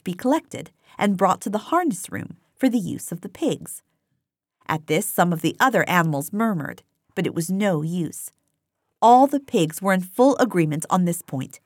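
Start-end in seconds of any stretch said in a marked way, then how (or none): none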